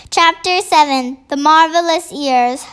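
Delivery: plain reading voice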